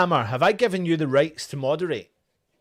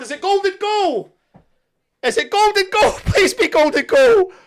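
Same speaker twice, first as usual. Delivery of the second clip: high, loud, breathless hope; giddy, pleading disbelief; excited pleading; 13 semitones higher, 5 dB louder than usual. Real, not acted. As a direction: beg the ref for golden goal. Hoping after the goal.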